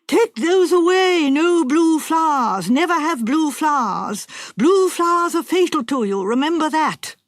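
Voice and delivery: cried warning. Retold or imitated. Wife acts the fortune teller crying out a warning. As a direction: cry out the warning like a seer. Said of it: "pointed at them and cried out".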